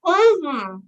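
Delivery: talking through cotton balls; mouth full. Real, not acted. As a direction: mumble past a stuffed mouth. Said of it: "I'll start with two cotton balls".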